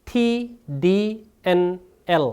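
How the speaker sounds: slow, separate "T D N L"; tongue-tip consonant drill. Shown as a demonstration. Teacher drilling four sounds for students, slowly and distinctly. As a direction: tap out "T D N L" one by one, tongue on the ridge. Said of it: "한 번 더요".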